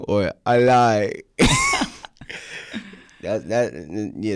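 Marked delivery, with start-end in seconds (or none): 0.4-1.1 put-on loud, higher Jamaican-accented outburst; Jamaican parent yelling. Guest imitates parents when he's in trouble, loud Jamaican accent. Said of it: "my parents yelled at me in Jamaican". 1.2-2.9 high burst of laughter. Real, not acted. Guest cracks up at his parent imitation, high laughing.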